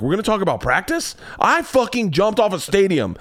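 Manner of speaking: high-pitched, incredulous mock rant; exasperated athlete's outburst played for laughs; Allen Iverson. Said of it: "turning into a version of Allen Iverson".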